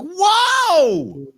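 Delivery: very high, loud yelp of shock; hammed-up shriek of disbelief. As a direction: squeal it like you can't believe your eyes. Shocked viewer reaction.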